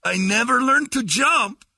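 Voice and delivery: high, slow plaintive voice; husband voice. Retold or imitated. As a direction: warn her, earnest and high.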